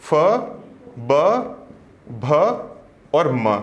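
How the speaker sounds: letters said singly with long pauses; slow letter recitation. Shown as a demonstration. Teacher slowly reciting the प row for the class. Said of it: "प में आएगा प".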